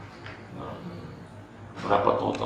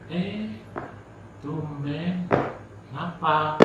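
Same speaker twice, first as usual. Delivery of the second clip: mildly surprised sibling; 9 semitones higher, 3 dB quieter, much slower than usual. Retold or imitated. Acting the sibling's surprised greeting.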